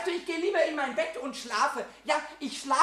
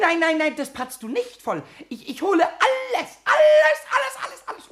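high, loud panicky squeal; desperate to keep him out of the kitchen; frantic pleading; 7 semitones higher, 7 dB louder than usual. Acted. Pumuckl squealing at Eder to stop him getting up.

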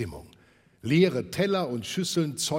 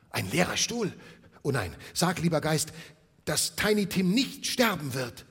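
louder, higher desperate plea; pleading; 6 semitones higher, 6 dB louder than usual. Acted. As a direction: beg the spirit in fear. Scrooge pleads with spirit.

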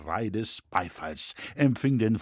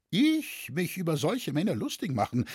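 higher, mildly indignant earnest denial; earnest protestation; 7 semitones higher than usual. A narrator's reading. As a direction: protest your innocence in all earnest. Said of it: "sagte Loy mit beteuerndem Ernste". (mit beteuerndem Ernst)

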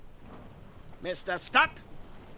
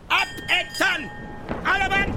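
shouted command; 9 semitones higher than usual. Acted. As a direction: call the whole crew down from the rigging. Ahab ordering the crew down. (hoher, lauter Befehlsruf)

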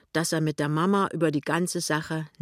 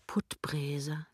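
soft whisper; hushed inner voice; thinking to herself; 8 dB quieter than usual. A narrator's reading. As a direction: whisper the name to yourself. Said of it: "denkt sie".

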